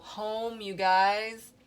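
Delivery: ordinary delivery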